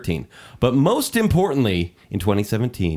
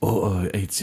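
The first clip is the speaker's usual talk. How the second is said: softer, half-hearted husky growl; Idris Elba; 2 semitones lower, 4 dB quieter, slower than usual. Pretend. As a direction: attempt a husky British voice and falter. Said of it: "Do your Idris Elba impersonation".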